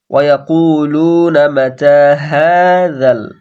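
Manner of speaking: slow tajweed recitation. Shown as a demonstration. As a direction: recite it slowly with full madd. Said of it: "তায়ে টান দেবো". (জোরে ধীর তিলাওয়াত)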